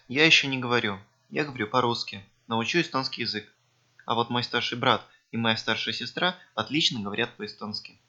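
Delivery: ordinary talk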